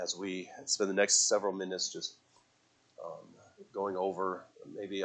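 soft, hushed opening prayer; quiet prayer. Acted. Pastor praying over teens and church, soft prayer voice. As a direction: pray it gently over the congregation.

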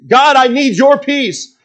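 high, insistent cry to God; fervent plea. Acted. Preacher voices a believer's prayer, fervent high plea. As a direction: plead with God, pitched well up.

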